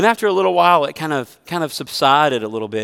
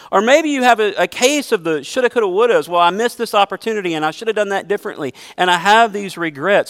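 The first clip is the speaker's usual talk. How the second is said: higher and louder, pressing the congregation; full-force preaching; 7 semitones higher, 4 dB louder than usual. Acted. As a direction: preach it hard, rising to a plea.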